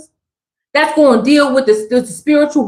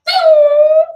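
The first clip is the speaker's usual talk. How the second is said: a sharp high ding, much higher pitched; ping it like a flash going off; bright comic ding noise; high ding sound effect; 13 semitones higher than usual. Pretend. Imitated memory-wipe sound effect.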